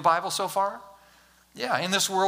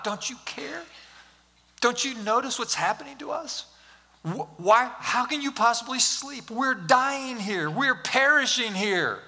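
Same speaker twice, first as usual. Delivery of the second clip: raised, pleading panic, slowing near the end; mock panic; 5 semitones higher than usual. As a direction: imitate the frightened disciples waking Jesus. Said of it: "They were freaking out".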